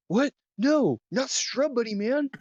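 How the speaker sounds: very high, alarmed stoner voice; Theo alarmed. Acted. Theo hears Shrub Buddy is hurt, high alarmed cry. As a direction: squeal it out in dismay. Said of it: "He's like".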